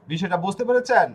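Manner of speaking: ordinary talk, nothing marked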